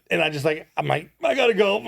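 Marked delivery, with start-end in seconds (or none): 1.2-1.9 choked up. Retold or imitated. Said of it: "started crying".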